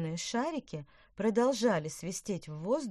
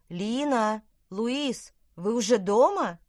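loud, higher motherly call; 5 semitones higher, 10 dB louder, slower than usual. A narrator's reading. Mother calls twins from the house, calling out. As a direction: call to children from a distance.

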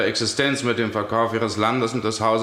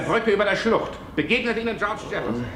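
higher, rising, feverish pressure; frantic accusation; 7 semitones higher than usual. Acted. Judge hounds Beverley in a fever dream, frantic. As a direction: pile on the accusation in a frenzy.

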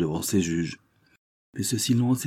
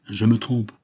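higher, louder mock admission; sarcastic concession; 4 semitones higher, 5 dB louder than usual. A narrator's reading. Countess pretends to correct herself to daughter, sarcastic. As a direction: concede with biting irony.

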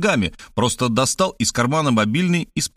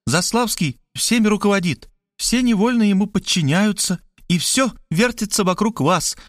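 higher, anxious rush of warnings; frightened pleading; 7 semitones higher than usual. A narrator's reading. Markhaleva frightened that Robert ends the talk, higher anxious pleading. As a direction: urge him, half panicked, to see the danger. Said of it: "Мархалёва испугалась".

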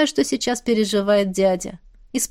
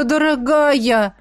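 slow drawn-out plea; pleading; 4 semitones higher, much slower than usual. Acted. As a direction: draw it out, begging. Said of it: "взмолился".

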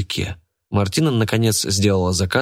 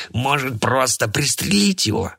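slow ominous raspy drawl; 6 semitones higher than usual. A narrator's reading. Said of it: "подал голос Отто Шлак".

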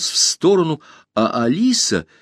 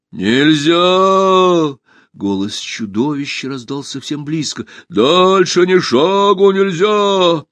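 slow, drawn-out booming creature call; muffled monster voice; 5 semitones higher, 4 dB louder, much slower than usual. A narrator's reading. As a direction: loom over the forest with a hollow voice. Monster voice again.

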